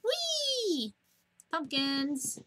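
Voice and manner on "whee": high squeal of glee; playful squeal while jumping; gleeful whee. Real, not acted. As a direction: let out a high joyful whee. Jumping in game.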